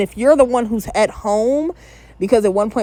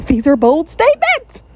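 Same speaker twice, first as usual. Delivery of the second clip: higher-pitched repeat in disbelief; incredulous exclamation; 7 semitones higher, 4 dB louder than usual. Real, not acted. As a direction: exclaim it, pitch lifted, taken aback. Exclaims on repeating her reaction.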